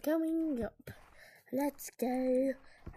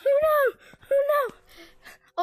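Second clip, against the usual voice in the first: panicked cry; 10 semitones higher, 8 dB louder, slower than usual. Real, not acted. Chased by the killer.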